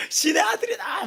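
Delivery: high, loud jubilant shout; cheering shout. Retold or imitated. Friends hailing the exempted boy, high jubilant shout. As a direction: cheer him like a miracle happened.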